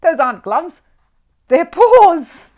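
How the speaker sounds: high, loud, offended outburst; hot indignation. A narrator's reading. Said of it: "exclaimed Paddington hotly".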